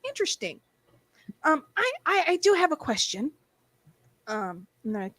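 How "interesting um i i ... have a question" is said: mock curious. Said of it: "And I'm like".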